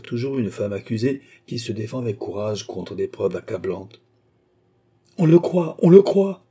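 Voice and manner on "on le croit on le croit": high, loud cry of outrage; frantic angry cry. A narrator's reading. Accused queen crying out in frantic anger before everyone. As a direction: cry out, beside yourself with anger. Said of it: "s'écria la reine éperdue de colère".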